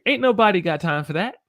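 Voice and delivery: high, loud, playful exclamation; sassy catchphrase. Acted. Teacher quotes a saying she loves, high loud catchphrase. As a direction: throw it out big and high.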